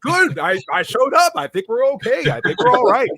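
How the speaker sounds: high, light hammed-up churchgoer; mock churchgoer. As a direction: reassure him you already showed up. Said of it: "It's like".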